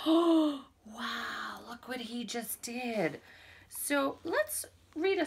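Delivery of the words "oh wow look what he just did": gasping, hushed wonder; amazed girl. Retold or imitated. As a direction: gasp and whisper in awe. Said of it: "the girl over here is like".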